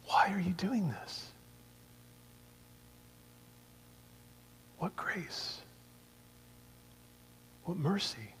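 whispered, slow and tender; hushed whisper. Acted. Jesus whispering to Saul, tender and hushed. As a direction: breathe it out softly, like grace.

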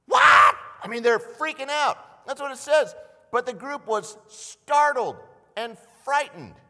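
half-acted panic, loud and high; loud alarm. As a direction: sound as frightened as they were. Describing the disciples freaking out.

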